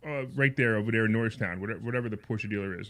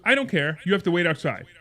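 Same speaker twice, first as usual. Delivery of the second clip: higher, louder mock-manager retort; dismissive order; 4 semitones higher, 5 dB louder than usual. Manager brushes off the buyer's protest, imitated dismissive order. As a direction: shut down the objection with a raised voice.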